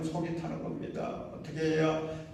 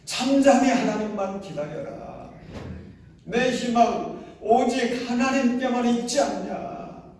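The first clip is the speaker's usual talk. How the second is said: raised, louder urging to oneself; self-exhortation; 8 semitones higher, 6 dB louder than usual. Shown as a demonstration. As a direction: speak to your own soul firmly. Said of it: "자기 자신에게도 해주세요". (자기 영혼에게 높고 크게 권면하듯)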